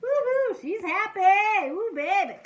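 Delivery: high, loud, stretched-out cheer; gleeful whooping. Pretend. Reader cheering at the teacher's happiness, gleeful whooping. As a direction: whoop and celebrate.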